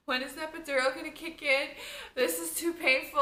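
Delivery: loud, high, panicked plea; reliving a desperate labour plea, loud and high. Retold or imitated.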